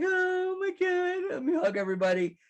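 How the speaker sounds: hammed-up oversensitive hugger; mock Mister Sensitive. Scene: the preacher mocks a gushing Jesus, hammed-up sappy voice. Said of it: "like Mister Sensitive".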